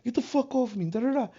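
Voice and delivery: high retold trash talk at the fakes; mock defiant trash talk, reliving the fight. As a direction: bark it back at them, defiant. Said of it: "I started talking my shit after that".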